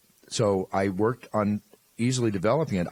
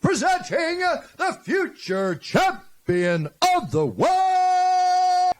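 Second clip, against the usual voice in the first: ring announcer; 5 dB louder, much slower than usual. Shown as a demonstration. Announcer demo.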